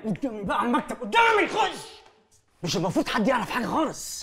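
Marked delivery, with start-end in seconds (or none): 0.0-2.0 sharp high shouted order; commanding shout. Acted. Father shouts at his son to get into the office. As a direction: bark him into the office.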